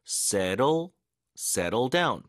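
"Settle" with the tt as a light tongue tap; slow, separate "Settle", then "settle down", tt like a Korean ㄹ; flapped tt. Shown as a demonstration. Teacher showing learners the sound change, "Settle" with flapped tt. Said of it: "중간에 티티는 리을 발음처럼 바뀝니다".

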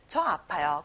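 ordinary talk, nothing marked

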